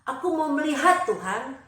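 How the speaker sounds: loud, high, urgent cry; eager plea. A narrator's reading. Bartimius answers Jesus at once, pleading loudly to see. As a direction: blurt it out and insist. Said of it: "Bartimius langsung bilang seperti ini".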